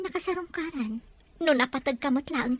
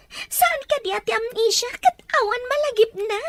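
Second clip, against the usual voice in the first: higher, pressing questions near tears; upset pleading; 7 semitones higher, 4 dB louder than usual. Acted. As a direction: plead in alarm for an answer. Wife pleading about amnesia.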